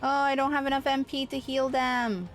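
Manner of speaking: ordinary talk, nothing marked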